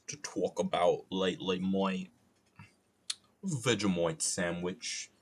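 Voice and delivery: slow, mock Australian drawl; Australian accent. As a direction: say it like a lazy Aussie. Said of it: "I need to stop with the accents".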